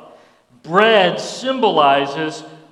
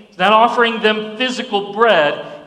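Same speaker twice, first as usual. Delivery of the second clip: usual speaking voice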